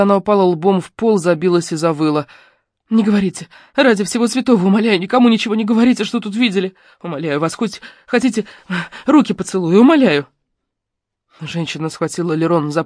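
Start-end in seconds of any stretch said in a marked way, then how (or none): 2.9-10.3 higher, louder, rushed begging; desperate pleading. A narrator's reading. Girl begs the woman to tell no one, desperate. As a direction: plead for your life.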